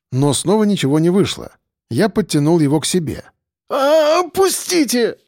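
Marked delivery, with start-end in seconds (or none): 3.7-5.2 high pained cry; pained groan. A narrator's reading. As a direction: moan it out in pain. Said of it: "простонал он". (жалобный стон, высоко)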